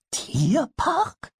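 very high, rising kobold question; a tiny goblin baffled by a new word; puzzled kobold. Acted. Pumuckl repeats the strange word to Eder, very high and puzzled. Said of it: "fragte der Pumuckl verwundert".

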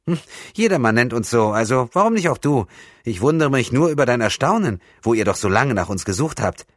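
put-on high, loud boyish voice; easygoing camp lad. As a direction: play a youngster brushing off an apology. Voices the young lad, Rasson.

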